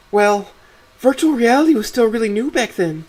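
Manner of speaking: very high-pitched, eager wife voice; defensive wife. Acted. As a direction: defend your favourite show in a high voice. Voices Stella defending the show.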